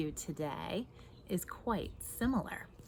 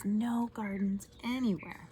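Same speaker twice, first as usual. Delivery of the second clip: usual speaking voice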